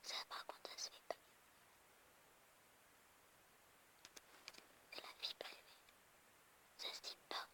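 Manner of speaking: hushed unvoiced whisper. Real, not acted. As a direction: whisper it from behind. Sister whispers in background. (chuchoté)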